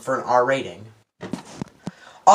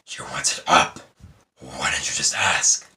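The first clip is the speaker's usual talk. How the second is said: deep, breathy monster voice, slowed; Venom voice; 14 semitones lower, 5 dB quieter than usual. Retold or imitated. Reviewer quoting Venom's line in a deep monster voice. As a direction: rasp it out low like the symbiote. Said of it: "I should probably stop saying it that way".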